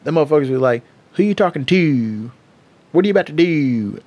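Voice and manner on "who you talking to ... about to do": lightly mocked Baltimore accent. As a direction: mimic how Baltimore locals talk. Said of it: "they accent".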